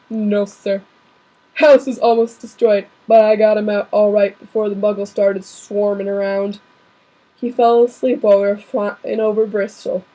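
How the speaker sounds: big friendly giant reporting in; lightly held gamekeeper voice; Hagrid voice. Acted. Reader keeps Hagrid voice.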